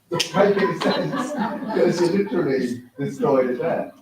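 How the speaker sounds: can't hold back a laugh at the absurdity. Real, not acted.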